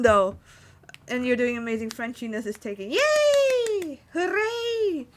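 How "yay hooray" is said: high, bright whoop of joy; yay and hooray squealed up high; cheering. Real, not acted. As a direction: cheer like you just won. Cheering a compliment.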